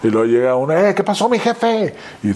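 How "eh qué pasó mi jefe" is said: high, chummy street-dealer greeting, voiced much higher; shady dealer. Bathroom stranger mistakes the actor for a buyer, high chummy hustler voice. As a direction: greet the boss like a sly hustler.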